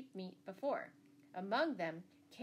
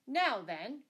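sudden higher, louder challenge; loud, sharp "who goes there" tone; sharp cry; 5 semitones higher, 8 dB louder than usual. A narrator's reading. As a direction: call out sharply to stop someone. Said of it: "cried the butcher sharply".

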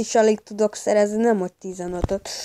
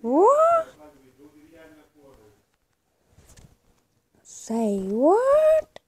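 shocked; 4 dB louder, much slower than usual. Real, not acted. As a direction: squeak out in shock. Shocked high exclamation.

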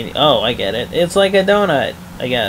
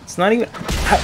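ordinary delivery

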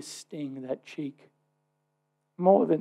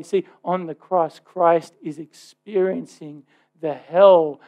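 ordinary talk, nothing marked

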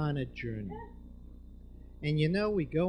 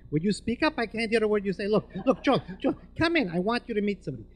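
put-on loud, high Eastern European accent; thick Eastern European; 5 semitones higher, 7 dB louder than usual. Doctor phoning Joel, loud thick Eastern European accent. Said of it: "He was a thick Eastern European".

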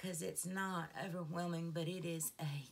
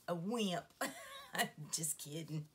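ordinary talk

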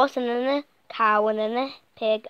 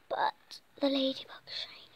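whisper; 3 semitones higher, 7 dB quieter than usual. Acted. Whispering to the listener.

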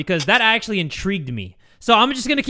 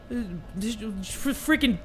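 halting, tripping start; nervous stuttering; 6 dB quieter, slower than usual. Real, not acted. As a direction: stumble haltingly on the first sound. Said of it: "why I was stuttering like that".

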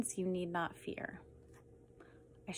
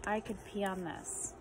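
hushed; 8 dB quieter than usual. Acted. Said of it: "repeated line, dropped to a hush".

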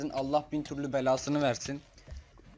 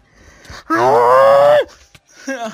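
frustrated scream-laugh; 23 dB louder than usual. Real, not acted. Failing the quiz question again.

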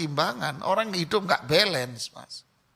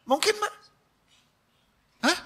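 much higher, mock disbelief; incredulous high question; 12 semitones higher than usual. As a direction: fling it up as an absurd question. Rhetorical mock question to audience. (pertanyaan tinggi tak percaya)

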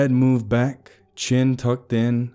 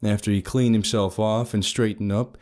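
plain reading voice

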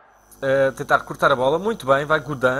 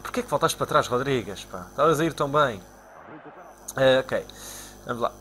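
ordinary talk, nothing marked

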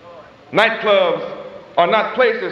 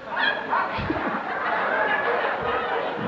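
breathy laugh; 8 dB quieter than usual. Real, not acted. Preacher laughs at own joke.

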